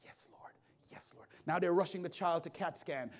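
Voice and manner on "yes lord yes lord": whispered thanks, much softer; hushed prayer. Retold or imitated. Chaplain quietly thanking God as the heartbeat returns. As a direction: breathe a private prayer of relief.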